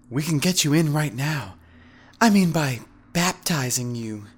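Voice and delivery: high feminine coaxing; coy, lingering innuendo; flirty woman missionary. A narrator's reading. Woman missionary coaxes him flirtatiously.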